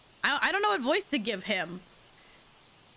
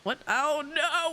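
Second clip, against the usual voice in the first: usual speaking voice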